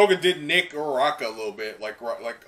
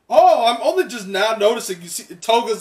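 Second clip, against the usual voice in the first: higher and louder, rushing with discovery; can't believe you missed it; real excitement while reading; excited; 9 semitones higher, 11 dB louder than usual. Real, not acted.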